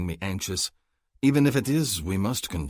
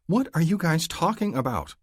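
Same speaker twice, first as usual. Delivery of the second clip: put-on young woman's voice; 11 semitones higher, 3 dB louder than usual. A narrator's reading. Female character confused.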